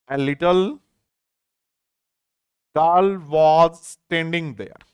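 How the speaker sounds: very slow, a long pause after "A little"; slow chunked reading. Shown as a demonstration. Teacher splitting the sentence to explain little, very slow. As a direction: break the sentence in two for the class. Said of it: "और समझते हैं".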